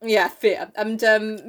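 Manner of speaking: ordinary talk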